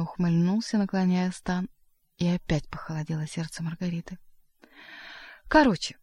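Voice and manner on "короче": high, louder exclamation; exclaiming. A narrator's reading. Koroviev cutting short his explanation to Margarita, exclaiming. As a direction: cry it out suddenly, pitched up. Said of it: "вскричал Коровьев".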